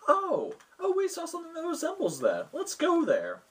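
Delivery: high-pitched mock-excited adventurer; acted excitement. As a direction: pipe up high, as if suddenly spotting a lead. Warlock acted spotting the amulet.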